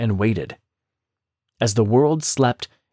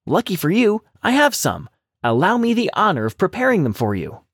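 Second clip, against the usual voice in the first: lightly raised, sly little rabbit voice; clever little rabbit; 7 semitones higher than usual. A narrator's reading. Rabbit sweet-talks the hungry tiger, higher and sly. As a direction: coax the tiger, politely cunning.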